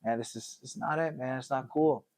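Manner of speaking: sad in the bar. Acted. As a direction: mope quietly over a drink. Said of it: "sad in the bar".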